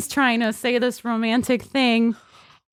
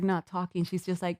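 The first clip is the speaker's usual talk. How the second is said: usual speaking voice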